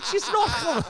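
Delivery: high, loud, laughing through it; squealed laughing punchline; laughing quote. Retold or imitated. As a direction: crack up while quoting the line.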